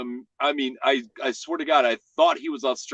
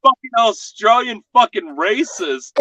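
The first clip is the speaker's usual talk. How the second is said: high, louder mock rant; 8 semitones higher, 5 dB louder than usual. Real, not acted. Joking rant to friends about Australians.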